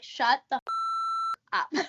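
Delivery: playing mom's thrilled disbelief; mock disbelief. Mom to daughter on the phone, thrilled disbelief.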